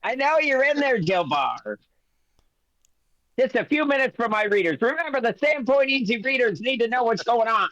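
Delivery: pushy reporter. Acted. Reporter calling through a door for a few minutes, pushy. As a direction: pester them through the door for a story.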